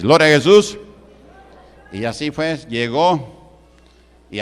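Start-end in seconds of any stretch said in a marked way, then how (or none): 0.0-0.8 loud ringing exclamation; shouted praise. Real, not acted. Pastor shouting praise to the church, loud and fervent. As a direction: call it out to the congregation.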